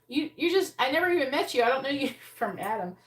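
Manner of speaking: ordinary talk, nothing marked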